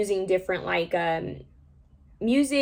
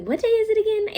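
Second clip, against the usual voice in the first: puzzled quote; 4 dB louder than usual. Retold or imitated. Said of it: "and I was like".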